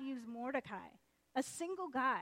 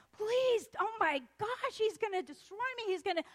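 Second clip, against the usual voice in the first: high, loud frantic pleading; panicked begging; 10 semitones higher, 8 dB louder than usual. Acted. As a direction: plead for your life in hammed-up panic.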